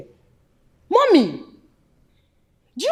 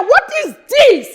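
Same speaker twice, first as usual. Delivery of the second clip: slow, higher, shouted; outraged cry; 6 semitones higher, slower than usual. Acted. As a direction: demand an answer in disbelief. Outraged at the family.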